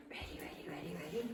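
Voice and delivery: whispered, teasing ready ready; hushed whisper. Real, not acted. Trainer teasing her dog with a hushed whisper before the toss. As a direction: draw the dog in with a secret whisper.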